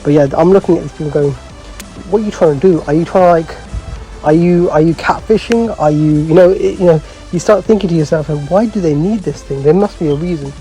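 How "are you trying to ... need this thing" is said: ordinary talk, nothing marked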